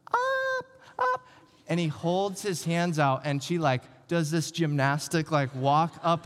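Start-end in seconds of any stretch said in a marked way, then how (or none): 0.1-1.3 put-on much higher little-kid voice pleading; a small child asking to be lifted; little sister begging. Little sister asks dad to carry her, high childish plea. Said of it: "she goes".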